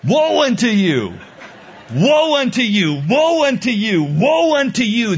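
booming, high prophet's proclamation; mock biblical prophet. Shown as a demonstration. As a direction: cry out doom like Isaiah. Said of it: "That was the proclamation of the prophet Isaiah".

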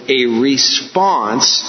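a response, drawn out very slowly; "response" said slowly and deliberately; stress on response. Real, not acted. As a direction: stretch out "response" for emphasis. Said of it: "I emphasize that word".

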